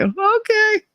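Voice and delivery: high, drawn-out sarcastic okay; a pointed, mocking okay. Real, not acted. Sarcastic aside after a remark.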